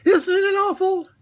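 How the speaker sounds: nervous little pig asking for reassurance; anxious Piglet. A narrator's reading. Piglet, anxious, as they near the trap, very high. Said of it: "said Piglet".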